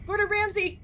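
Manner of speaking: high excited call of a name; excited exclaim. Real, not acted. Streamer spots a teammate named Gordon Ramsay, calling out excitedly.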